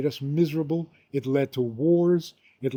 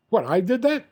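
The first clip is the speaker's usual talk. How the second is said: surprised protest; 7 semitones higher, 5 dB louder than usual. Acted. Man suddenly realises he has a maker.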